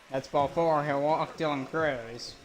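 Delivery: brisk announcer call of the play. Acted. Streamer calling his own video game, play-by-play voice. Calls walk and fly ball.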